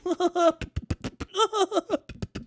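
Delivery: high, hammed-up blubbering sobs; mock sobbing. Streamer imitating heartbroken fans, mock sobbing. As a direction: fake a helpless, stuttering cry.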